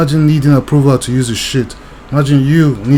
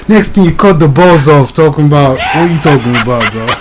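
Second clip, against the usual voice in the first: repeats the line louder, cracking up; laughing; 3 dB louder than usual. Real, not acted. Vlogger repeats his joke to viewers, laughing hard. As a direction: choke it out while laughing.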